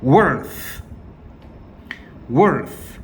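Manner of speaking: "Worth, worth" modelled with a clear final th; each "worth" ending in blown th; repeat-after-me pronunciation. Shown as a demonstration. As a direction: say "Worth" then "worth" separately for learners to copy. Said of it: "자, 따라합시다".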